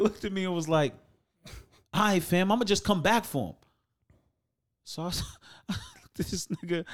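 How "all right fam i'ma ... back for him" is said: customer voice. Retold or imitated. Customer threat voiced high.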